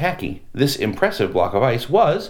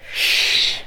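urgent whispered shh; whispered shush. Acted. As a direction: hush the others. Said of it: "they whispered".

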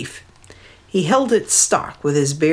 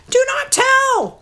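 much higher, slow bird call. Acted. Hidden birds warning the leader, slow high call. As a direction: call out from hiding like small birds. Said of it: "called the other birds".

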